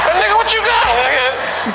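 mock suspicious call. Calling to chewing cousin.

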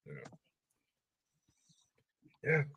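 ordinary delivery

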